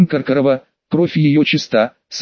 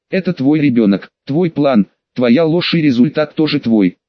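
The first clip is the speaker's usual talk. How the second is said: plain reading voice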